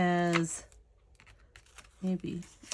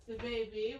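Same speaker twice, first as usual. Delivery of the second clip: ordinary talk, nothing marked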